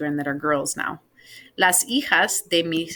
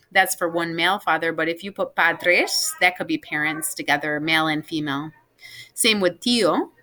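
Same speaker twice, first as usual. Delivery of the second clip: normal talking voice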